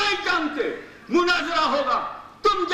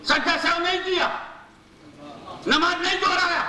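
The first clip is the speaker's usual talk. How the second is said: rising, ringing sermon cadence, pitch climbing with each line; preaching at full force; 4 semitones higher than usual. Acted. Preacher rousing the congregation about Husain in prostration, impassioned sermon. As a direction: hammer each phrase home to the crowd.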